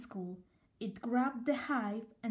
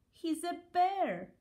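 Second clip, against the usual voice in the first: higher, slow, landing hard on bear; triumphant reveal; 7 semitones higher than usual. A narrator's reading. Amelia exposing Norman to the bees, slow triumphant reveal. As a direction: reveal it slowly, punching bear. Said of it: "said Amelia".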